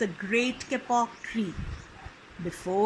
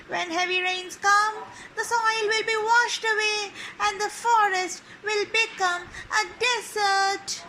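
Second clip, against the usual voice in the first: higher, chattering monkey voice; 7 semitones higher than usual. Acted.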